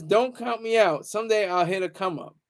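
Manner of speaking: normal talking voice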